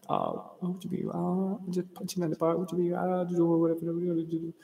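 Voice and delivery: quiet, mumbled friend impression; imitating a friend. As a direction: mumble it like your buddy. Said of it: "He talk like that".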